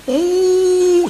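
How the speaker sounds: high, drawn-out mock sob "oh"; long high wailing note; imitated wail. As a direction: imitate someone bawling on your shoulder. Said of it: "dia teriak atas bau saya".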